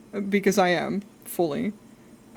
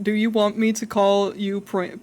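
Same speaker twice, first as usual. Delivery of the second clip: normal talking voice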